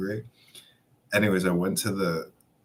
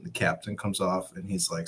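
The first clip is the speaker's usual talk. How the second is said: usual speaking voice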